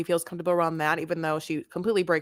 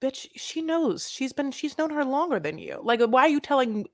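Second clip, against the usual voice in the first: sassy clapback; 7 semitones higher than usual. Retold or imitated. Co-host imagining telling TC off, sassy retort. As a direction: snap back at him with attitude. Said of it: "I wanted to be like".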